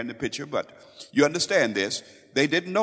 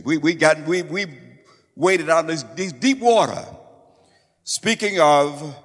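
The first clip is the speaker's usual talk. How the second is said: fervent preaching; 2 semitones higher, 6 dB louder than usual. Real, not acted. Preacher builds to fervent emphasis.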